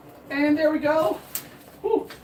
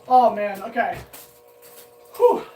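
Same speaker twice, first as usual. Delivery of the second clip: out of breath; 4 semitones lower than usual. Real, not acted. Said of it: "Need to catch my breath".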